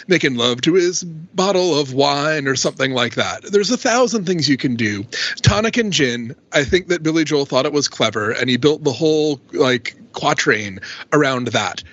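normal talking voice

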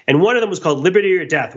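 ordinary talk, nothing marked

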